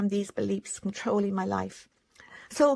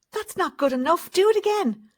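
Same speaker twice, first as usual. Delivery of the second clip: put-on louder and higher, a parent snapping at a child; harsh parent demand; 7 semitones higher, 5 dB louder than usual. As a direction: demand it again, sharply. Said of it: "And here they are".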